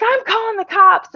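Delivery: high-pitched mock yell; imitated yelling. Director threatens fleeing residents, mock yelling. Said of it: "she started yelling out there".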